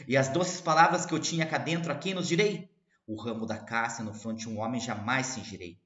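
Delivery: declaiming verse; poem recital. Acted. Teacher reciting to students, declaimed. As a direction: read it out as poetry.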